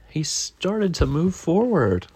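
slow, suspenseful rise in pitch; slowly. A narrator's reading. Narrator tells Daniel's first ride, slow and rising. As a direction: stretch it out with building wonder. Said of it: "And then slowly".

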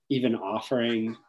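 ordinary talk

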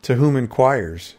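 plain reading voice

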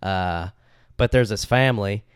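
put-on Southern storytelling; playful Southern US accent. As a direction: tell it with a Southern twang. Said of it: "tell the story in an accent".

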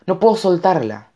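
high, urgent appeal; desperate plea. A narrator's reading. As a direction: plead for help, pitched high. Boy begging the tree for help. (ruego agudo y urgente)

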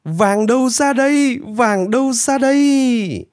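very high, long-drawn cry of joy; elated high-pitched whoop; joyful cheering. A narrator's reading. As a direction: call out for the gold in glee. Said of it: "Nanpu sung sướng reo hò".

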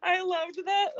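real laugh through the words, pitched high. Real, not acted. Podcaster cracking up over the sling scene, laughing.

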